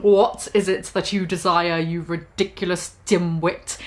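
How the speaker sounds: a lightly voiced cold professor; cold Snape voice. Narrator voicing Snape mildly sneering at Ebony, low and cold. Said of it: "Snape demeaned angrily in his cold voice".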